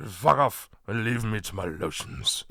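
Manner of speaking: put-on posh British accent, low. Retelling a YouTube clip in the man's British accent. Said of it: "he's got a very British accent".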